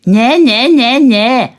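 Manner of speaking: loud, high nyah-nyah mimicry; mocking squawky imitation. A narrator's reading. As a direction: squawk back at her mockingly. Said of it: "äffte Simpel ihre quäkende Stimme nach".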